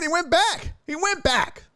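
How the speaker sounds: high, hyped-up repetition; excited insistence. Real, not acted. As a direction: pitch it up and drive the point home.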